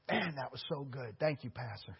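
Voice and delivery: low, very soft voice; imitated grateful disciple. Disciple thanking his pastor after a talk, hushed imitation. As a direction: drop right down for the disciple's thanks.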